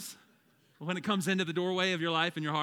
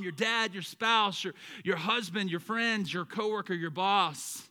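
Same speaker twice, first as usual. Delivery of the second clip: normal talking voice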